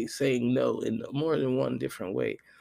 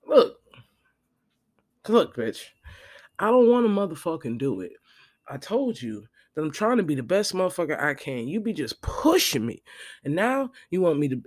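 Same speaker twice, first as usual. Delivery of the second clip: louder, heated telling-off; retold blowup; 3 semitones higher, 4 dB louder than usual. Teller finally cursing out the friend, louder and heated. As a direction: finally lose patience.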